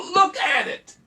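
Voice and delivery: high, loud shout; full worked-up shout. Real, not acted. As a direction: yell it at full force. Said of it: "I'm getting worked up here".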